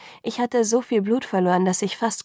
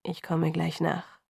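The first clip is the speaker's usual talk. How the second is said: slow, softer polite reply; lightly voiced Edward, slow and softer; polite, distant; 3 semitones lower, 6 dB quieter, slower than usual. A narrator's reading. Edward brushing Bella off, soft slow polite reply. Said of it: "mit einem höflichen Lächeln".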